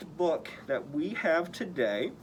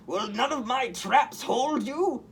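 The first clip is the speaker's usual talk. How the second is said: much higher, louder villain voice; shocked Goblin roar; 9 semitones higher, 5 dB louder than usual. A narrator's reading. Goblin shocked Spider-Man escaped, high roaring villain. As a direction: roar it in disbelief. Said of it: "the shocked Green Goblin roared".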